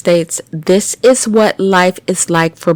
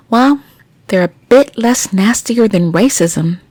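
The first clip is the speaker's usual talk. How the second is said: ordinary talk, nothing marked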